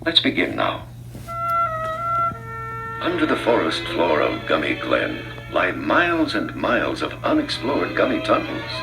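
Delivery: ordinary delivery, nothing marked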